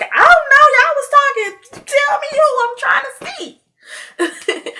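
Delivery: shrill-high, loud mock outburst dragged out to the end; mock exasperation. Teasing her friend about his secret crush, mock-exasperated yelling. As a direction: yell it high and stretch the last words, half laughing.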